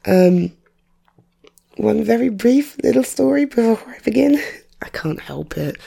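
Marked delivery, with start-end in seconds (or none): 1.8-5.8 hushed, secretive confession; whispered aside. Real, not acted. Conspiratorial aside to viewers.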